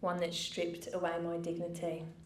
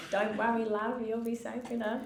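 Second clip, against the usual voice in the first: put-on lighter Australian accent, a touch louder; 4 semitones higher, 5 dB louder than usual. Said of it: "I've gotta do your accent".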